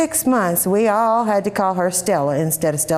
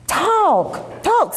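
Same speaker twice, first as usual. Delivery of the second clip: insistent; 14 semitones higher than usual. Acted. Mama demanding answer.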